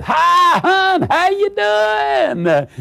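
very high, drawn-out singsong greeting; cheery calling out. Acted. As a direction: sing it out to your spouse on coming home. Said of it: "whistling and singing and call out".

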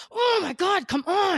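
high whiny frustration; mock frustrated yell. Woman losing patience with packaging, high and whiny. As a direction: plead with the package, annoyed. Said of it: "yelling at the packaging".

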